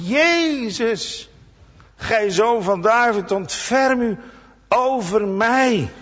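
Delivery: high pleading call, stretched at the end. Retold or imitated. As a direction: cry out for mercy from a distance. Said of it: "die begint te roepen".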